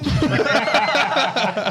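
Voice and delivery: high burst of laughter; loud laugh. Real, not acted. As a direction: cackle out loud. Laughs at own joke.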